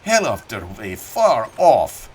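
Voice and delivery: put-on thick German-accented old farmer, reminiscing; a proud old German immigrant telling his war story. Old German farmer telling Annixter about Gravelotte, in a thick German accent.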